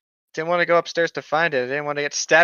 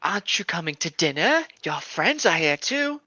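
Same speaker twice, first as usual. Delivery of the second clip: put-on English accent; 2 semitones higher than usual. Reading the note in a lightly English voice. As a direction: read the note as a creepy Englishman. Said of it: "is he English?".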